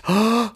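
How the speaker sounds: sharp gasp of shock. Acted. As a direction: suck in air in alarm. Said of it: "and then they gasped".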